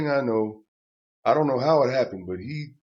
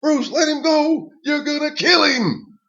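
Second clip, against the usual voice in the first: much higher, loud yelling; frantic shout; 8 dB louder than usual. Retold or imitated. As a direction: yell at Bruce in panic. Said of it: "And I'm going".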